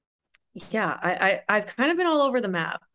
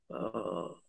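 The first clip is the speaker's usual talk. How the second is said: dreading sigh; 12 semitones lower, 12 dB quieter than usual. Retold or imitated. Dread at a long date.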